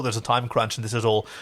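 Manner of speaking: ordinary talk, nothing marked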